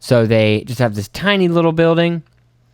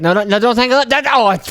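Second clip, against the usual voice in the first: high, urgent no no no; alarmed warning; 11 semitones higher, 4 dB louder than usual. Retold or imitated. Fellow fisherman warning him off, high and alarmed. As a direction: stop him before the lines tangle. Neighbor warns about tangling.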